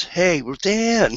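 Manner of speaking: high, slow call of the wife's voice. Retold or imitated. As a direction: drag out the name like a wife calling across the cottage. Wife calling to mother-in-law.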